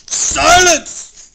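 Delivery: high, loud furious bark; barked command. Acted. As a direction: cut Mac off hard. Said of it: "the king of the turtles barked back".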